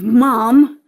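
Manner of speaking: loud scandalised cry of Mom; shocked shout. Acted. Daughter shocked as her mother is about to moon her, loud yell.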